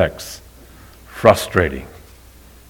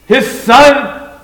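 excited recognition; 6 dB louder than usual. Acted. Father spots returning son.

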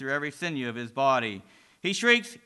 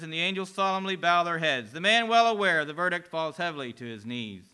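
ordinary delivery

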